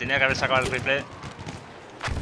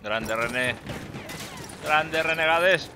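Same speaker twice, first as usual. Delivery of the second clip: slow, stretched ironic praise; drawn-out sarcastic praise; much slower than usual. Real, not acted. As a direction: drag it out mockingly. Elogio irónico al equipo. (elogio irónico muy lento)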